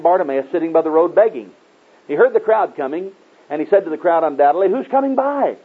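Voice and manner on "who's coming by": high-pitched questioning call. Retold or imitated. Blind Bartimaeus asking the passing crowd, calling out. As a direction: call out as the beggar, voice lifted high.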